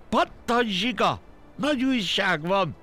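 high, urgent call to hurry; excited calling. A narrator's reading. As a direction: shout out big news in a high voice. Deer calls snail with news. (izgatott, magas hangú hívás)

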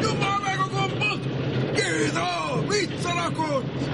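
high, stretched-out yell; exasperated high yell; urgent shouting. Acted. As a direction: shout an impatient order across the floor. Urgent order to stop the belt.

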